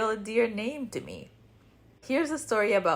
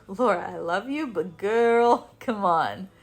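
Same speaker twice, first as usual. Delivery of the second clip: put-on affectionate reproach, louder on "girl"; kindly calling out; 5 dB louder, slower than usual. Candid friend setting Laura straight, kindly exasperated. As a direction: lovingly chide a friend, leaning on "girl". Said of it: "look at me kindly and go".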